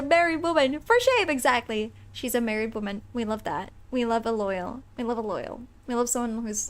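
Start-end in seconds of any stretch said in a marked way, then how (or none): none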